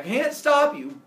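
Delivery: loud, high exasperated plea; acted emphatic warning. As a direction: warn them forcefully, voice jumping up. Helios resumes his warning.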